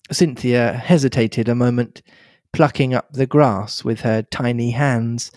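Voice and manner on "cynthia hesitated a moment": ordinary delivery, nothing marked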